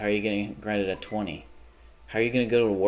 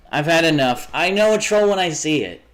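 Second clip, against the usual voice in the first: higher and louder, furious; angry shout; 7 semitones higher, 6 dB louder than usual. Real, not acted. Streamer shouting down a chat troll, angry. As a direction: yell it at the troll.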